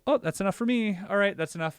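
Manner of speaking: high startled retreat. Retold or imitated. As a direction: back off fast from the sight. Seeing his own body.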